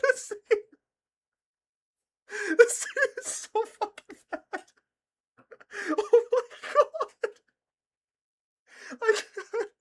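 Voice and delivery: high-pitched words squeezed out through laughter; can barely talk for laughing; laughing hard. Real, not acted. Cracking up at the scene.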